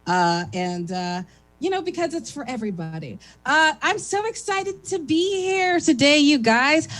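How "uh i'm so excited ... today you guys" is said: higher and louder, gushing; gushing excitement. Real, not acted. Comic gushing to the crowd about being there, loud and high. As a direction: burst out how thrilled you are.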